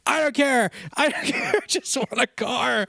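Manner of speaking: high, whiny, fading to breathy; whiny mock customer. Salesman imitates upset wife, high whiny pout.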